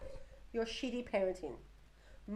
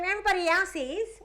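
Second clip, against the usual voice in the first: put-on defiant teen; 9 semitones higher, 6 dB louder than usual. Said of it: "she's like".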